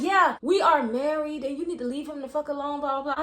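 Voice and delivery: mock a wife laying down the law; imitating angry wife. Wife angrily warns her off, retold.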